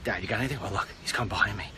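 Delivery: hushed, secretive whisper. Real, not acted. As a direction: whisper so they don't hear. Whispered aside while playing.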